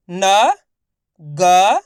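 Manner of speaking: लटक pulled apart letter by letter, loud; very slow sounding out. Shown as a demonstration. Teacher sounds out लटक very slowly, loud. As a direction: drag each letter of लटक. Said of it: "मैं किस तरीके से एक एक अक्षर".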